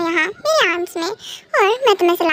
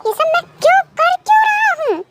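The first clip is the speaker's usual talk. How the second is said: pitched way up in frustrated disbelief; exasperated self-questioning; 9 semitones higher than usual. Acted. Jealous husband asks himself why he cares, exasperated. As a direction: let the question climb high, exasperated.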